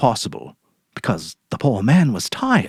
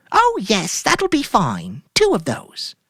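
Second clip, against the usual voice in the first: much higher, airy put-on cheer; forced cheerful wife; 11 semitones higher, slower than usual. Mary ordering groceries from Sam, falsely cheerful. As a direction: play it breezy to hide guilt.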